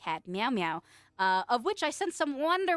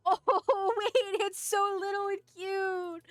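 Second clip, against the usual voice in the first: much higher, slow cutesy gush; gushing coo; 8 semitones higher, much slower than usual. Real, not acted. Streamer gushing to chat over the tiny owl, cutesy. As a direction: squeal over how tiny it is.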